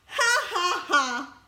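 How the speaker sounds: high, spaced-out mock laugh dropping at the end; forced laugh. Laughing at own doomed relationships, forced laugh. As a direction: laugh it off bitterly, syllable by syllable.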